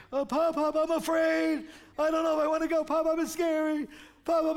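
put-on high, pleading little-girl voice; scared little girl. Granddaughter begging grandpa from the top of the slide, high and scared. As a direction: play the frightened granddaughter stuck up the slide.

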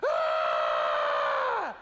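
loud startled yell, low and hammed-up; startled scream. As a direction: let out a big frightened Ah. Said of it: "they all start just going".

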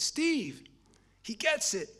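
high, loud, excited call; a friend bursting with happy news on the phone. Retold or imitated. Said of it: "he's all excited".

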